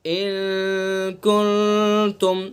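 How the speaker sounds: इन कुंतुम slow and long, the n hidden in the nose; nasal ikhfa. Shown as a demonstration. Teacher showing the right nasal reading, slow recitation. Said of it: "नाक के बासे से अदा करेंगे".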